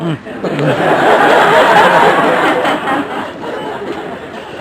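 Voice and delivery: long high burst of laughter; hearty laugh. Real, not acted. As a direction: laugh out loud at your own joke. Speaker laughs at Nixon joke.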